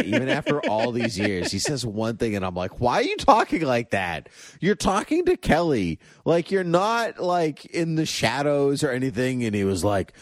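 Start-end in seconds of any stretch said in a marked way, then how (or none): 2.8-9.4 higher-pitched mock scolding; exasperated. Fan complaining at James about his Guardian voice, exasperated. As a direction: question him in comic disbelief.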